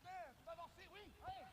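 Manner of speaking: high, coaxing call to a horse. Real, not acted. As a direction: gently urge the animal forward.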